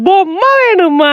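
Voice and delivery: put-on high, very slow cooing to a baby; coaxing baby talk. Parent offering baby a toy, coaxing. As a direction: draw it out to coax a baby.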